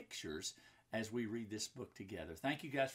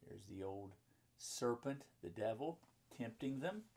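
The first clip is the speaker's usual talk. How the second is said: ordinary delivery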